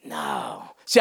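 usual speaking voice

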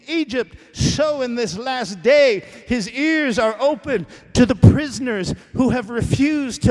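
pulpit-pounding sermon voice; preaching at full force. Acted. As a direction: thunder it down like a prophet. Sermon climax, shouting.

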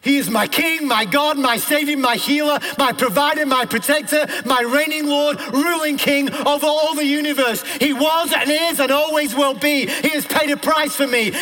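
high, loud declamation in driving bursts; full-force preaching. Real, not acted. Preacher declaring who God is to the congregation, full-force preaching. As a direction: proclaim each title of God at full pitch.

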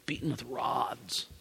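a hushed echo of the horror; whispered repeat. Acted. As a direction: let the words fade into a whisper. Repeating the torture for weight.

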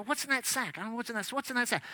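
whispered aside. Retold or imitated. Whispering to sister in service.

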